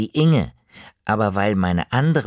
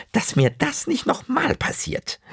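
hohe Omastimme, etwas verlegen erklärend; erleichterte Oma. Acted. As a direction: gib kleinlaut zu, dass du schlecht geschlafen hast. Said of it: "meinte Oma Otto". (Oma, hoch und verlegen)

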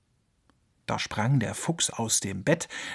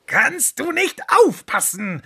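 slow, much higher, louder scolding; shrieking scold; 13 semitones higher, 5 dB louder than usual. Acted. Fox scalded by the milk, shrieking angrily. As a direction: screech at himself in fury. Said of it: "keifte er".

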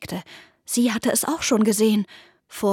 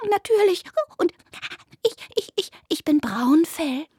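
higher rabbit voice stuttering on ich; nervous stammer; 7 semitones higher than usual. Acted. Rabbit nervously introduces itself to the cats. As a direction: still shaky, trying to be brave.

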